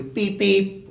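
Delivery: two high beeps like a car horn; imitated car horn. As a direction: imitate honking on the way out. Imitating departing cars honking.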